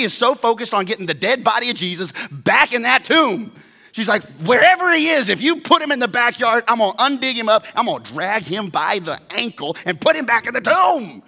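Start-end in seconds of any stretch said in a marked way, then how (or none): 4.4-11.2 much higher, loud, rushed; frantic determination. Acted. Mary vows loudly to drag the body back. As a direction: storm in ready to dig him up. Mary's frantic resolve voiced.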